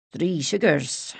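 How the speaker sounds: slow, gruff grunt. A narrator's reading. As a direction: grunt it out slowly like a gruff farmer. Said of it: "he grunted".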